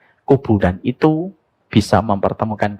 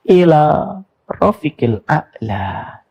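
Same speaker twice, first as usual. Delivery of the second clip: slow solemn recitation; 5 semitones higher, much slower than usual. Retold or imitated. Prophet's dying words quoted.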